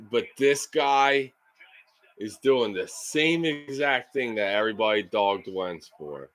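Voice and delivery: ordinary delivery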